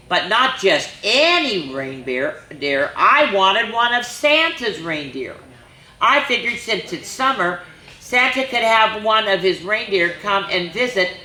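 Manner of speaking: put-on lively storybook reading for little kids; reading to children. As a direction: read it like story time at the library. Reads picture book to children.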